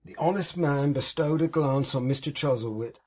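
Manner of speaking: usual speaking voice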